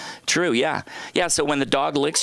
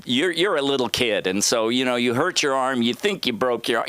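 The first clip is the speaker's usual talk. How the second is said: ordinary talk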